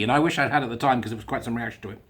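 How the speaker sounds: usual speaking voice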